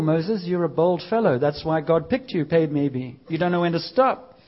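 usual speaking voice

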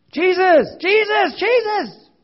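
high, loud desperate cries of the name; desperate screaming. Retold or imitated. Preacher voicing the blind beggar crying out to Jesus, desperate high screams. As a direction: scream it like a blind beggar with one last chance. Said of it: "he just starts screaming".

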